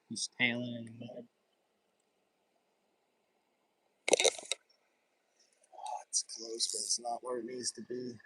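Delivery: hushed, soft stalking talk; fishing whisper. Real, not acted. Quiet stalking near a fish.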